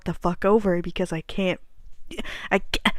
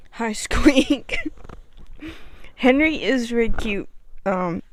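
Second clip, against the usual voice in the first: ordinary delivery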